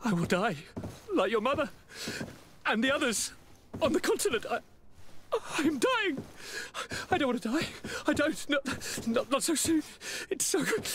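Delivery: clinging to life, breaking into panic; panicked fear of death. Acted. Dying cousin panics.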